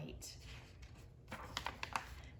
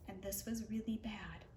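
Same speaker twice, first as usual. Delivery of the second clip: hushed aside; 3 semitones lower, 8 dB quieter than usual. A narrator's reading. Suspense in a bedtime story.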